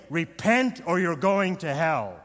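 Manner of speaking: loud hellfire preacher demo; Southern Baptist voice. Shown as a demonstration. Preacher showing there are times to warn, loud Southern Baptist voice. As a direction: thunder the warning like a Southern Baptist. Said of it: "That's my Southern Baptist voice".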